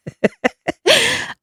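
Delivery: a short high laugh at herself; amused laugh. Real, not acted. Laughing at her own nastiness to viewers, short high laugh.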